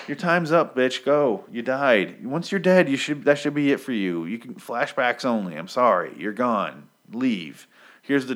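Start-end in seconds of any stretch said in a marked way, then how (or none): 0.1-4.2 higher and louder mock scolding of a ghost; telling her off. Addressing dead Lori as if she were there: mock angry dismissal. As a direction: shoo the dead character off the page.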